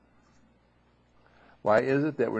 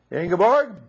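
much higher, louder call of the name; raised voice; 11 semitones higher, 9 dB louder than usual. Retold or imitated. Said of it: "And I'll raise my voice".

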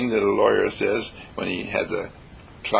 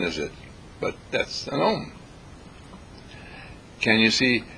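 ordinary talk, nothing marked

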